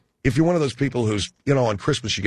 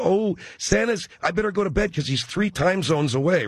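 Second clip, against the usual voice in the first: put-on excited little kid; 6 semitones higher than usual. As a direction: play a wide-eyed kid who believes in Santa. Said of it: "the kids are all".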